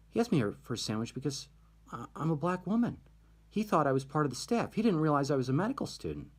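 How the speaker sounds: retold indignation. Offended student explains the sandwich request, retold indignation. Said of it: "she was mad about this".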